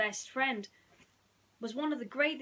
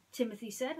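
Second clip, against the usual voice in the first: ordinary delivery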